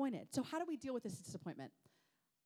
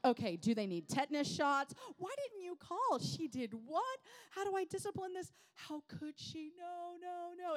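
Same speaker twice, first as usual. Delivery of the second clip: loud, high frantic questions; acted horrified mom, fading at the end; mock panic; 10 semitones higher, 8 dB louder than usual. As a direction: spiral into hammed-up parental panic. Retold panic at teacher's news.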